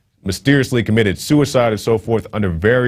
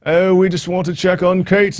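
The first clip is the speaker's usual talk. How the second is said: high, hammed-up fake British accent; mock a royal with a bad British accent; a British accent done badly on purpose, pitched high; horrible British accent; 12 semitones higher than usual. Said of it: "That's a horrible accent".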